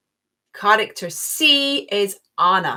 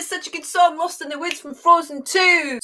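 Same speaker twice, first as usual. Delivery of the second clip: much higher, louder burst of delight; excited gush; 9 semitones higher, 4 dB louder than usual. Real, not acted. Quiz host gushing as the Frozen two song clip ends. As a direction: beg for the song to keep going.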